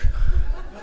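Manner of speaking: a quick laugh at his own joke; short breathy laugh. Real, not acted. Preacher laughing at his own butcher joke, breathy chuckle.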